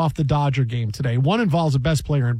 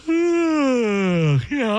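a drawn-out stretching yawn with a high sigh; big yawn; 7 semitones higher than usual. Shown as a demonstration. Host demonstrating the yawn, stretched out and high. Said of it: "I'm tired".

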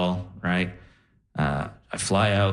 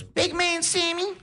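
high, put-on Def Jam comedian voice; crazy Cat Williams accent. Grandma on morphine greets grandson in a high comic accent. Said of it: "talking in a crazy accent".

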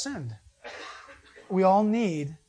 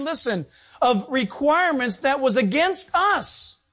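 preaching scripture; 7 semitones higher, 5 dB louder than usual. Acted. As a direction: proclaim each phrase.

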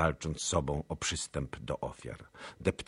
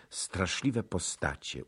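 ordinary delivery, nothing marked

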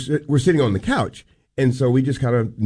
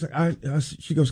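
ordinary delivery

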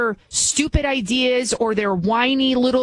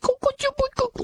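soothing coo; faster than usual. Retold or imitated. Host mimicking the mom calming her boy, very high coo. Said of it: "petting the kitty".

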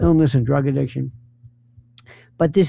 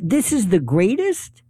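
ordinary talk

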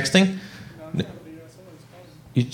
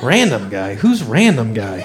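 low, slow puzzled mumble; baffled self squinting at phone; 8 semitones lower, 4 dB quieter, slower than usual. Retold or imitated. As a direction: drag it out low and confused. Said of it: "And I'm like".

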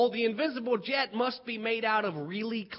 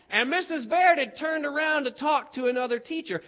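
ordinary talk

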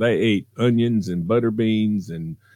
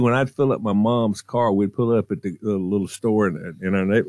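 normal talking voice

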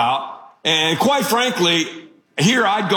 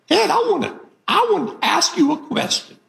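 put-on Southern mother-in-law accent; 12 semitones higher than usual. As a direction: do the mother-in-law's thick Southern accent, pitched way up. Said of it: "She has a one-of-a-kind Southern accent".

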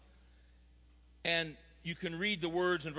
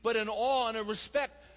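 higher-pitched, emphatic sermon delivery; impassioned preaching; 6 semitones higher than usual. Real, not acted. Preacher urging fathers to represent God, impassioned. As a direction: drive the point home to the congregation.

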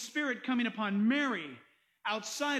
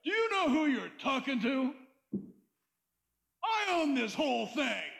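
a lightly voiced smug rich man, brushing off the warning; slightly mocking voice of someone who owns it all; proud self-satisfied person. Preacher mimics a proud unbeliever, lightly smug. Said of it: "People are like".

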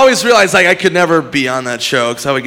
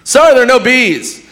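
mock host; 10 semitones higher, 5 dB louder than usual. Pat voiced in imagined scene.

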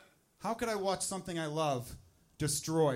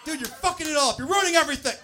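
much higher, louder scolding yell; angry dad; 11 semitones higher, 11 dB louder than usual. Pretend. Father yelling at newborn son, mock angry outburst. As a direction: blow up at the newborn in mock fury. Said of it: "Be like".

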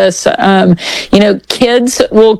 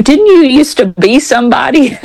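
laughing; 5 semitones higher than usual. Retold or imitated. Quoting stranger, laughing through it.

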